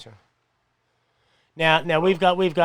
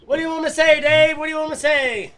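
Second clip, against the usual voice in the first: playful shout; 11 semitones higher, 7 dB louder than usual. Real, not acted.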